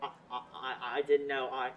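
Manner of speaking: quiet, faltering stammer; stammering fear. Acted. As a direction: trip over the words in fright. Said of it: "Oh, he's quivering".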